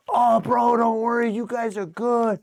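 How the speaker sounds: high easygoing reassurance; friendly gangster. Retold or imitated. Gangster reassuring Morpe, high easy tone. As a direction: wave off the worry.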